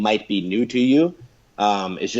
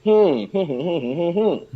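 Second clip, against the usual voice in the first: high hummed sentence melody; swooping closed-mouth hum; humming; 9 semitones higher than usual. Shown as a demonstration. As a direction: hum a speech contour, no words. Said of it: "if you just kinda hum it".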